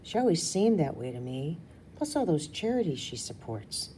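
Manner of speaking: ordinary delivery, nothing marked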